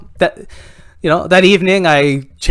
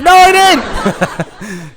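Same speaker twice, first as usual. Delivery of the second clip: high, raised voice, drawn out; dad's amazed reply; 14 semitones higher, 4 dB louder, much slower than usual. Acted. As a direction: play along, loud and high. Dad answers daughter in story.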